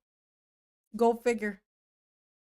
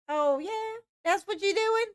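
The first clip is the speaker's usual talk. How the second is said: mock surprise; 10 semitones higher than usual. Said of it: "It was like".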